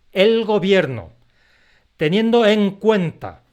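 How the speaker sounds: acentos en todas partes, más agudo, fuerte y lento; político enfático. Retold or imitated. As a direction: imita a un político leyendo un discurso fino. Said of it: "tan enfáticas, que son típicas de los políticos". (lectura enfática de político, acentuando cada palabra)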